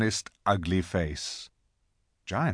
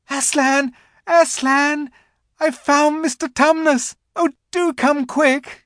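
Lucy's very high, urgent call; excited shouting; 7 dB louder than usual. A narrator's reading. Lucy fetches Aslan to the found faun, excited shouting. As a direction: cry out high and loud to fetch help. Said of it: "Lucy came rushing upstairs shouting out".

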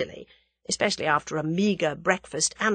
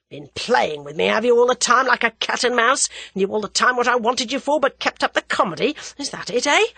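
loud, raised, accusing voice; angry crook confronting; 6 semitones higher, 5 dB louder than usual. A narrator's reading. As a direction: rail at someone who has been toying with you.

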